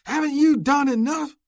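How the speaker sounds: high, loud enraged yell; furious. Acted. Oscar accuses Luke, enraged yell. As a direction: hurl it as an accusation.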